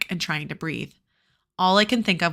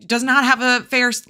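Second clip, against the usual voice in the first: ordinary delivery, nothing marked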